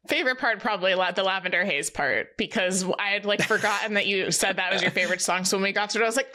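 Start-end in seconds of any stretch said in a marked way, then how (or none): none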